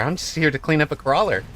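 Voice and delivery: voicing the unit. Voicing the unit mid-cast.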